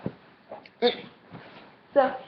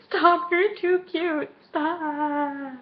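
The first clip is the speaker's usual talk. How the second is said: high, drawn-out coo; smitten gushing; 6 semitones higher, much slower than usual. Retold or imitated. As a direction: melt into a slow, high squeal of adoration. Gushing at his hot chocolate gift.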